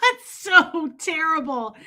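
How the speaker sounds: high, drawn-out words through laughter; slow down as real laughter takes over; laughing. Real, not acted. Streamer cracks up at her own scalper bit, real laughing.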